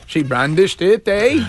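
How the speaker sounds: Canadian "eh?" tossed up high at the end; mocking the Canadian officer with a rising "eh"; mock Canadian uptalk. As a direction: tease it like a Canadian, pitch jumping on "eh". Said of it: "oh, Canadian".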